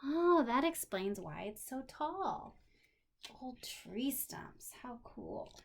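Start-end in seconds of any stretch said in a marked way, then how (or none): 3.4-4.7 much softer, slow repeat; gentle slow echo of the words. Shown as a demonstration. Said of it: "repeats phrase softly". 4.8-5.5 hushed whisper of wonder; soft awed whisper. Real, not acted. Whispered aside to child.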